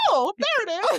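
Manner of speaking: high, loud imitation of a dawning thought; hammed-up lightbulb moment; mock sudden realization. Teasing a friend's returning thought, mock sudden realization. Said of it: "and was like".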